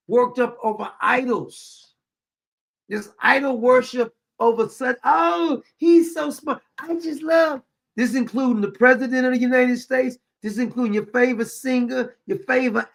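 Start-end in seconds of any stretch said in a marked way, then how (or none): 5.0-7.6 high, gushing starstruck fan; lightly mock a swooning admirer. Mocking people who worship celebrities, in a high gushing voice.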